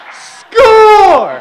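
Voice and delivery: very high, roaring victory shout; triumphant cheer. Retold or imitated. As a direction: yell it like a winning goal. Gleeful cheer at finding rod.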